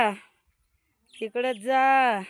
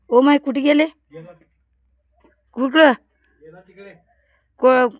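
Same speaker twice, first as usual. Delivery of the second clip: alarmed cry; 7 dB louder than usual. Real, not acted. Snake suddenly out of sight.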